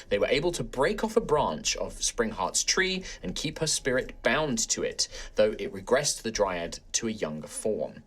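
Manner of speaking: measured storyteller recap; story recap narration. A narrator's reading. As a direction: narrate the recap over music. Recap narration over music.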